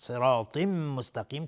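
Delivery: "صراط مستقیم" slow, held humming m between words; nasal merging. Shown as a demonstration. Teacher shows ghunna, "صراط مستقیم" with held m. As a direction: merge into "مستقیم" with a nasal hum on m. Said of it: "ادغام با غنه".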